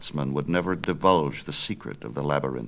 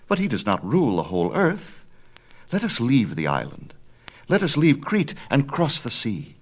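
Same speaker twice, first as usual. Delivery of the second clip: put-on higher, bold young son; eager young Icarus; 8 semitones higher, 4 dB louder than usual. Son argues with his father, higher and bold. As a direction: push back, higher and bold.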